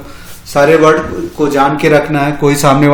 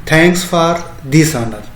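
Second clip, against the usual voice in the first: very slow "Thanks for this honor"; slow demo phrase; much slower than usual. Shown as a demonstration. Teacher modelling a polite thank-you for students, very slow. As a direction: lay out every word of "Thanks for this honor". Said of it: "इसको English में क्या कहेंगे".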